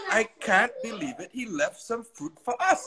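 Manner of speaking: high, wondering disbelief, acted out; Chi Chi amazed. A narrator's reading. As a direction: let amazement push the pitch way up. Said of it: "said in amazement".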